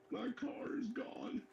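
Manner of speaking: put-on high, slow, dazed drunk voice; lost and confused, drawn out; bewildered drunk. Drunk man, car missing at 3am.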